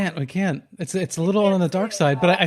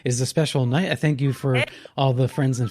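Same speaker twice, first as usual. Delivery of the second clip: slow, low late-night radio host; ominous late night radio; 4 semitones lower than usual. Acted. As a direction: draw out the full moon welcome. Radio host opens the segment.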